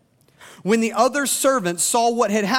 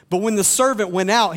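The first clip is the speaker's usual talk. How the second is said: ordinary talk, nothing marked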